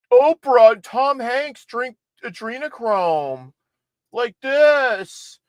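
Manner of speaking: high, sneering mock voice; mocking conspiracy theorists. Host mocking QAnon fans in a high, sneering voice. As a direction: parody the believers as gullible. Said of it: "All these QAnon morons".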